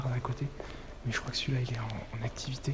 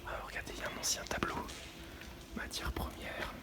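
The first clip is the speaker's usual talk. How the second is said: sneaking through a warehouse whisper; hushed, careful exploring voice; 8 dB quieter than usual. Real, not acted.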